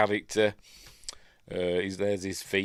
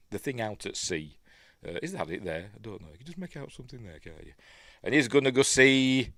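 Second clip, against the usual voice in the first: ordinary talk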